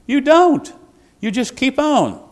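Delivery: preacher's emphatic retort. Real, not acted. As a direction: slam the answer down hard.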